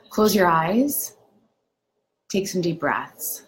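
unhurried meditation guide with long pauses between phrases; guided meditation. Acted. Doctor leading viewers through a lakeside meditation, unhurried guided-meditation delivery. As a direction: guide them gently into calm, phrase by phrase.

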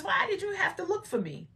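put-on high-pitched questioning boy; imitating the boy. Repeats boy Jesus's reply.

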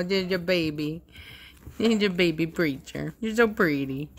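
usual speaking voice

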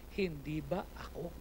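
low, soft and slow divine question; hushed. Retold or imitated. God asking Moses quietly, low and slow. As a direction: drop to a low murmur.

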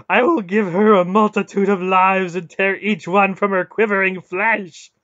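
high, bellowed threat; raging god's savage cry; howling fury. A narrator's reading. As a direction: howl the threat at full force. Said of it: "Mara howled".

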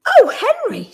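high, loud dismayed cry; heartfelt outcry, pitched right up. A narrator's reading. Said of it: "she cried".

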